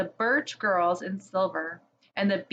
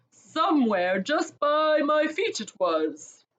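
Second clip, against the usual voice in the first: big giant voice, just waking; a good-natured giant rubbing sleep away; sleepy giant; 3 semitones higher, 3 dB louder than usual. Acted. Giant waking up, asking about the little witch, dazed. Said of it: "and said".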